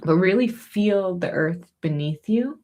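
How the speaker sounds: normal talking voice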